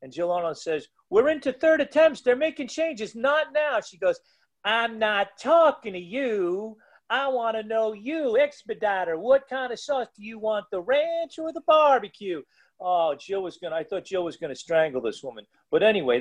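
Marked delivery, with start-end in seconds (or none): 1.1-3.8 much higher, clipped and impatient; imitating an irritated Jill brushing off the lunch lady; exasperated official. Jill rebuffs lunch lady mid-meet. 4.6-12.4 hammed-up Southern lady ignoring the referee; Southern accent. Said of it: "with a Southern accent".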